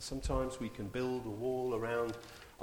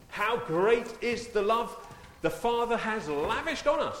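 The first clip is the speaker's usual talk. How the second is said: higher, louder, slow preaching; rising preaching; 9 semitones higher, 5 dB louder, slower than usual. Acted. Preacher proclaims the Father's love to the church, rising. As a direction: lift the praise up high and slow.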